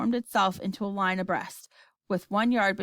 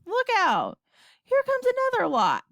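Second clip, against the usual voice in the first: high, alarmed warning; shouting; 13 semitones higher, 4 dB louder than usual. A narrator's reading. Astronaut seeing another wave coming, shouting. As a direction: cry a sudden warning. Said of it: "shouted Shuckworth".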